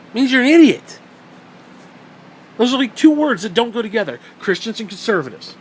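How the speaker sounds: ordinary talk, nothing marked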